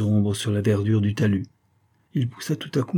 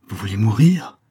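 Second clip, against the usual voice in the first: louder, urgent cry of alarm; alarmed exclamation; 4 dB louder than usual. A narrator's reading. Jacques to the girl he saved, alarmed exclamation. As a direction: blurt it out in fear for her. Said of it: "s'écria-t-il".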